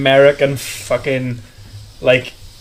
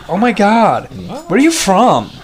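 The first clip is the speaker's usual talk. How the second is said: put-on lightly done American girl, higher pitch; a hint of a chirpy American tourist; American accent; 4 semitones higher than usual. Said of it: "an American fucking, like, you know, Yank".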